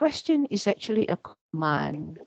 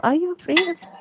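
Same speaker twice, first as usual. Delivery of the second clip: rhetorical question; 10 semitones higher, 4 dB louder, faster than usual. Shown as a demonstration. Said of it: "here is a question".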